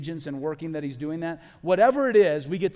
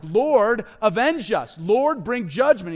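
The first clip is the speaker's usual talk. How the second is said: loud, high, slow plea; martyrs crying out; 6 semitones higher, 11 dB louder, much slower than usual. Retold or imitated. Martyrs under the throne crying to the Lord, loud pleading cry. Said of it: "These guys are crying out like".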